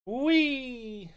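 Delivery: high squealing whee; a gleeful squeal for the dog; playful whee. Acted. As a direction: cheer him on like a ride. Lifting dog for a fun ride.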